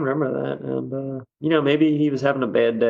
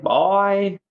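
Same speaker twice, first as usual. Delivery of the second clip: put-on "boy" drawn out and pitched high, Southern style; Southern drawled boy; 7 semitones higher than usual. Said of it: "he's got a Southern accent".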